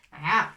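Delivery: A pressed from deep in the throat; pron throaty a. Shown as a demonstration. Teacher shows students how the throat forms the ayn. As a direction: squeeze the a from the middle of the throat. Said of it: "apprendre la gorge à sortir cette lettre-là".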